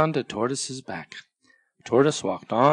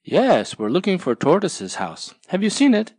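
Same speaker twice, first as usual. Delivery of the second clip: higher, louder hopeful tortoise; Tortoise answering; 7 semitones higher, 4 dB louder than usual. Acted. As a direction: ask eagerly for help.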